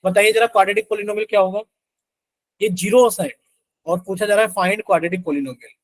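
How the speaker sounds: normal talking voice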